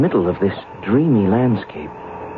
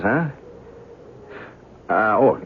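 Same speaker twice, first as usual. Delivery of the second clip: ordinary delivery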